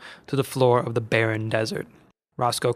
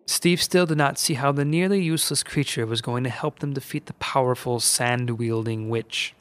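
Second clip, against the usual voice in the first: usual speaking voice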